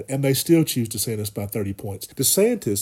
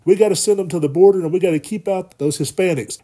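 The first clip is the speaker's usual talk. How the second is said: mock DeSantis; 6 semitones higher, 5 dB louder than usual. Mocking DeSantis ordering troops.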